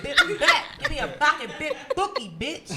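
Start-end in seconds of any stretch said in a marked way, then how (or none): 0.8-2.3 "pocket big bookie" with every hard C turned into a B, laughing; C swapped for B. Shown as a demonstration. Joking to friends about gang slang, laughing B-for-C swap. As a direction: say "pocket big bookie" loud, B sounds where C would be. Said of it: "You can't say the C".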